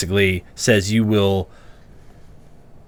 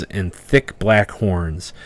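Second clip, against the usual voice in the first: usual speaking voice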